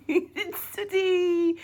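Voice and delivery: high, loud and slow; excited reveal. Real, not acted. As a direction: announce the surprise guest with delight. Excited reveal of the puppet to children.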